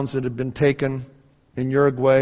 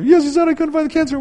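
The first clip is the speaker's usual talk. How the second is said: very high mock-tearful wife voice; 7 dB louder, faster than usual. Wife echoes husband's guess in amazement, high mock-crying voice. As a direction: imitate her overwhelmed, pitched way up high. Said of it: "and she starts crying".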